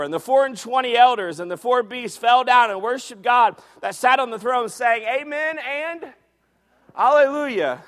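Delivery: loud, rising scripture reading; preaching at full force. Acted. Preacher reading scripture to the church, booming. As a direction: proclaim it to the congregation. Preacher reads Revelation aloud.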